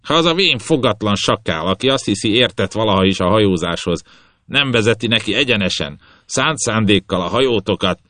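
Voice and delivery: put-on captain scorning the toothless old jackal; scornful captain. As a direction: spit out the insult. Captain curses old helmsman.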